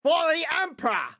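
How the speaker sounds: high, loud ogre war cry; Ogren. Acted. Ogre turning on the party, high battle cry. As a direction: bellow it as an eager ogre. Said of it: "the Ogren will then look at you guys and go".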